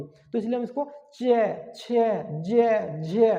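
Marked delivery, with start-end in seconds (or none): 1.1-3.3 चे, छे, जे, झे drawn out one by one; press, then slowly release each of चे, छे, जे, झे; slow, lengthened चे, छे, जे, झे; slow-release pronunciation. Shown as a demonstration. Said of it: "धीरे धीरे उठेगी".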